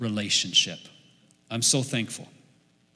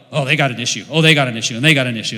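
louder, sneering "Oh, they got an issue"; mock judgmental voice; 2 semitones lower, 8 dB louder, much faster than usual. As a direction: lampoon the person who sees faults everywhere. Mocking a judgmental hypocrite.

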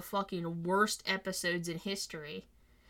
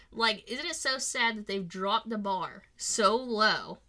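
ordinary talk, nothing marked

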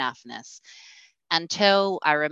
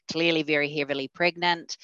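normal talking voice

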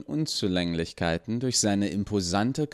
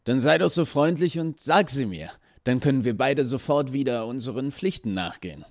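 higher, eager gatekeeper asking nicely; 6 semitones higher than usual. A narrator's reading. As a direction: coax her politely, a bit anxious. Nervous gatekeeper pleading. (höher, eifrig bittender Torwächter)

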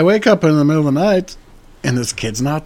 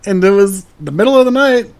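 worked-up outrage; 8 semitones higher, 5 dB louder than usual. Real, not acted. Retelling outrage.

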